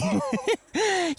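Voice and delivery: high, mocking little laugh; smug laugh. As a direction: laugh down at the rival, amused. Rivals taunting each other.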